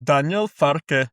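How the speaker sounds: slow, attempted German accent. Asked to say the manager's name, in a German accent. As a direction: put on a questionable German accent. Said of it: "do the German accent and his name".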